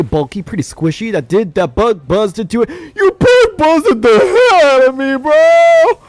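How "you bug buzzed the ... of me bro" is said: mock crying whine. Mock whining after bug hit.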